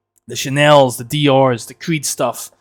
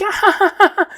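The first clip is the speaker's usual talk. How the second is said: very high mock cackle; mimicked hysterical laughter. Kid laughing at dull videos, high mocking imitation. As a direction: fake an over-the-top giggle fit. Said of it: "he's laughing".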